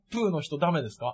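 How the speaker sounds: soft, halting foreigner-like phrasing; quiet, stilted question; broken-Japanese delivery. Real, not acted. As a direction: ask timidly in stilted Japanese. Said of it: "なんで片言なんだよ".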